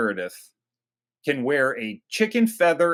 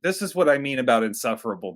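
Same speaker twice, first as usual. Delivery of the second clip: ordinary talk, nothing marked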